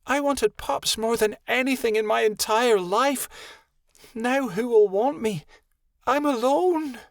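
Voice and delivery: a grieving she-wolf breaking down; crying out. A narrator's reading. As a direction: wail it, building to a howl. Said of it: "Lottie cried".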